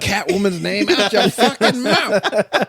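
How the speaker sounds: loud, high, drawn-out pretend outburst through laughter; mock-furious yell. Joking co-host playing an offended actor confronting presenters, mock-furious shout. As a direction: bellow it slowly as a hammed-up threat. Acting out an imagined angry outburst.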